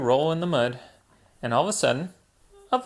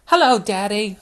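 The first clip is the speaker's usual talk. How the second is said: a raised, lightly voiced little boy piglet; a small brother saying hello, a bit higher; George's voice; 7 semitones higher, 5 dB louder than usual. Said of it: "said George".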